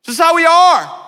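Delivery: high, loud brash boast; mock your old brash self, laughing; loud Italian bravado, hammed-up. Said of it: "I was like".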